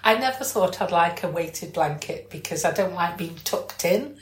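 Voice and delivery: normal talking voice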